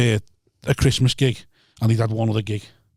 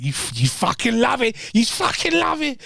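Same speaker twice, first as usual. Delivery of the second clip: much higher-pitched mocking imitation; mimicked goading comic; 11 semitones higher than usual. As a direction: squeal it in a put-on high voice, goading.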